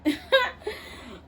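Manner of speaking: high, helpless burst of laughter; falling-out laugh. Acted. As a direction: crack up at the absurdity. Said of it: "fell out laughing".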